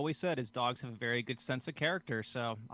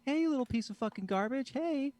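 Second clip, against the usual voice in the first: high singsong call to a dog; affectionate pet-talk intonation; 13 semitones higher than usual. Acted. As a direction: coo it like praise for a puppy. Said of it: "it's all the intonation of your voice".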